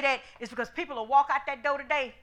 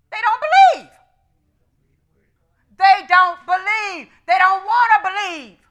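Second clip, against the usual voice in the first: emphatic preaching; 5 semitones higher, 10 dB louder than usual. Acted.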